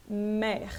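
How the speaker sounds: mer with open E and an audible French R; pronounced final R. Shown as a demonstration. French teacher showing a monosyllable keeps its R, R sounded. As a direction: hold mer through to a rasped final R. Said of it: "vou pronunciar esse R".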